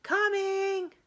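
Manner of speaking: high, loud answering call; girl answering mom from treehouse; calling back. A narrator's reading. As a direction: yell it out the window.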